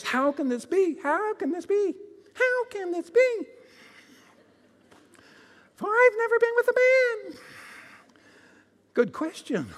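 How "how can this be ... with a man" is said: Mary's disbelief building up and up; astonished Mary. Acted. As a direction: pitch climbing higher with each question, ending very high. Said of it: "Mary goes".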